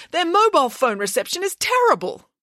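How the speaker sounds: high, fussy complaint; fussy teacher. Acted. A flustered teacher complains about the phone, high-pitched. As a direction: gripe like a flustered schoolmaster. McLean's voice.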